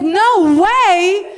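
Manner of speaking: mock refusal. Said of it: "I said".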